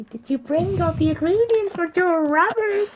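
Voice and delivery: high, loud cackling witch. Acted. Witch greets returning Cinderella with a high loud cackle. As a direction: cackle and screech like a hag. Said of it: "the witch is like".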